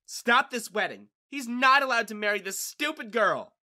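loud, high outraged demand; angry objection. A narrator's reading. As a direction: shout the objection down the aisle. Furious sister objects at wedding.

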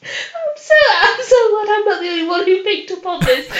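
high-pitched, tearful laughing through the words; real giggling. Real, not acted. Co-host relieved someone else picked up on it, giggling. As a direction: struggle to talk through giggles. Helpless giggling over shared joke.

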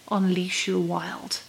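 slow, soft, mock-solemn title; mock dramatic title. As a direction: intone it like a self-help slogan.

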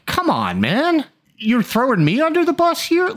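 mock-outraged son thrown under the bus; whiny Eric. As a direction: play Eric, stung and indignant. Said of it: "if I'm Eric, I'm like".

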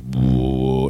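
low, sustained imitation drone; long low ominous hum; horror drone. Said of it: "this horror drone continues underneath".

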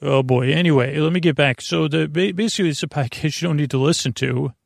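ordinary talk, nothing marked

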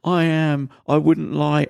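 high-pitched mock pleading; imitated tearful girl. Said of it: "this girl starts crying".

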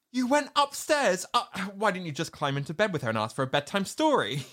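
high, incredulous you went upstairs, then fast scorn; shocked sarcasm. Retold or imitated. Emily mocking Andy for going upstairs, shocked then fast. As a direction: gasp it out, then rattle off the jibe.

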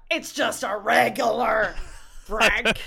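loud imitation of a Texan drawl; mock Texan accent. Showing his friend how it sounds, in mock Texan. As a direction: tease with a put-on Texan accent. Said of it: "a bit Texan though".

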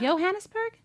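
high rising disbelieving question; surprised echo. Real, not acted. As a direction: echo the name back in shock. Surprised echo of city name.

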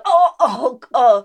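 loud mock moaning; hammed-up pained groans; cramping groans. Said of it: "you really do go".

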